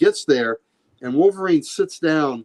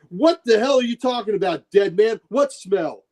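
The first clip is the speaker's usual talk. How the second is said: high-pitched, indignant mobster demand; angry challenge; 5 semitones higher than usual. Acted. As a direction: act out an incredulous tough guy's challenge. Acted mobster challenges Wolverine.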